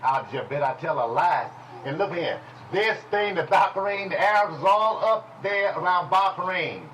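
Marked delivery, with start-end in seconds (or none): none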